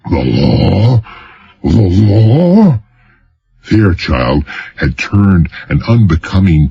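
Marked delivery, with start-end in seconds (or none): 0.1-2.8 outraged governor spluttering "The law?"; outraged repeat. Acted. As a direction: echo it in rising disbelief. Governor outraged at being threatened.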